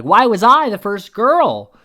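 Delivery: high, loud imitation of indignation; mock upset. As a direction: wail the complaint, rising at the end. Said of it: "she's upset".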